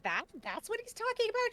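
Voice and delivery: high, incredulous question; surprised. Retold or imitated. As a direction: squeak it out in disbelief. Realizing what the neighbor meant.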